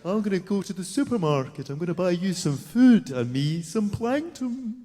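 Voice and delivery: mock posh mum, gliding vowels; put-on posh Scot mum, rising at the end; posh Scottish mum. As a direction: coo it like a posh Scots mother. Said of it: "my mum was going".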